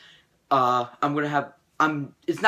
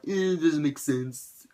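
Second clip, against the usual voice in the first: mocking whiner. Mocks people who trash fantasy films, snobbish put-on voice. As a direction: sneer it like a snob. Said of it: "They're like".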